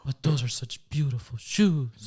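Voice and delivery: overdone sympathy, drawn out; mock sympathy. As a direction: coo it like soothing a child. Mocking sympathy over ugly shoes.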